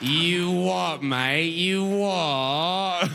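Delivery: stretched-out hammed-up Cockney drawl; mock British accent. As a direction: savor each British vowel as pure sound. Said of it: "making sounds you like".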